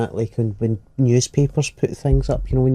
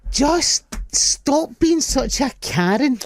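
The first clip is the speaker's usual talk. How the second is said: higher, very slow, sarcastic comeback; mock retort; 12 semitones higher, much slower than usual. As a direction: drag it out to shut down a nitpicker. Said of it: "And I'm like".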